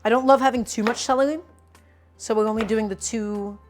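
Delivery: ordinary delivery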